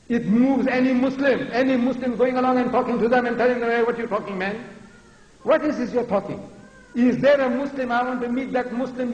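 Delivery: high, indignant preaching; fervent, exasperated oratory; impassioned challenge. Real, not acted.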